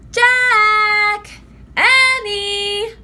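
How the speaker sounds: high, loud sung-out call from far away; distant call. A narrator's reading. Mom calling her children from far off, sing-song. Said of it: "a voice called from a distance".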